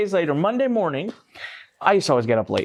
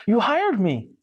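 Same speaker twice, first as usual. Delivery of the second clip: higher, mildly wounded voice; quivering-lip hurt; 8 semitones higher, slower than usual. Retold or imitated. Friend in a suit pleading with his supposed boss, mock hurt. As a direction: imitate someone close to tears, lightly. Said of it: "bottom lip quivers".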